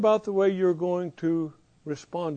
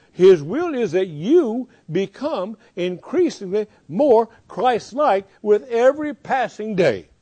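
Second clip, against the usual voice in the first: slow and deliberate, every word weighed; slow emphatic preaching; 3 semitones higher, 5 dB louder than usual. Real, not acted. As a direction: lean in and stretch it out.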